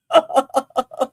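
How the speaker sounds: short bright laugh; amused laugh. Real, not acted. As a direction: laugh it off at yourself. Laughs on live.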